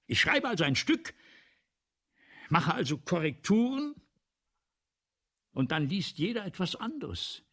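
deutlich lauter und höher, sich in Rage reden; erregter Ausbruch. Acted. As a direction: aufgebracht über die eigene Halbblindheit. Autor gerät über Schrift in Rage. (lauter, hoher, erregter Ausbruch)

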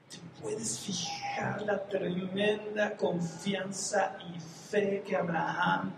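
slow, whispery wonder; soft, drawn-out reverent appeal; hushed awe. Real, not acted. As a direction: draw it out in a near whisper, deeply moved. Hushed awe closing the point.

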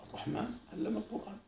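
almost murmured, letting الرحمن and علم linger softly; hushed contemplative recitation. Shown as a demonstration. Teacher showing students how the verse touches the soul, hushed. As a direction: say it softly, savouring each word. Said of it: "لما أنت تقول".

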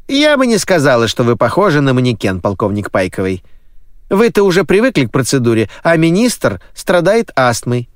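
high, brisk nanny voice. Acted. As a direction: speak up high as a fussy housekeeper. Nanny answers the colonel.

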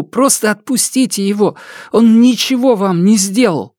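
high, desperate plea for a friend; frightened pleading. Acted. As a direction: beg in panic, voice climbing. Kaylin pleads with the shadow.

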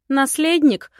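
high, loud scoffing question; snorting. A narrator's reading. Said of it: "фыркнула я".

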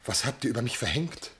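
hushed despair. Acted. Husband despairs to the gods.

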